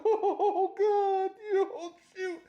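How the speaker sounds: high, loud flustered laughter; real giggly, high-pitched fluster; flustered laughing. Real, not acted. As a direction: laugh through a scandalized outburst. Flustered reaction to steamy line.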